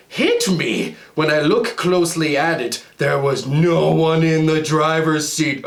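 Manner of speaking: usual speaking voice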